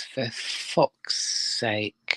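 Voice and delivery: lightly voiced swearing parrot; low, soft, drawn-out mimicry; parrot impression. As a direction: mimic a parrot's muttered curse. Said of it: "all you heard from the parrot was".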